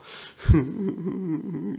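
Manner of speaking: a high, bright burst of laughter. Real, not acted. Preacher laughing at the congregation mid-sermon. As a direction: laugh at your own picture of God. Laughs while telling the scene.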